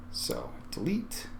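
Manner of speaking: soft whispered murmur to himself; whispered aside. Real, not acted. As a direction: breathe it out while clicking. Clicking delete, quiet aside.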